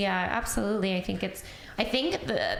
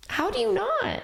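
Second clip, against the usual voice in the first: incredulous; 14 semitones higher than usual. Real, not acted. To hosts about crying, an incredulous high question. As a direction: cry out how anyone could not. Incredulous at holding back tears.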